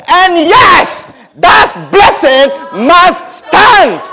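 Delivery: loud, slow, high decree; solemn declaration. Acted. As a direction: lay it down as final. Isaac declares firmly.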